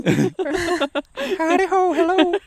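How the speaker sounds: very high, slow cartoon voice; Butters. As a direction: draw out a squeaky little greeting. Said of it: "Yeah, she has Butters".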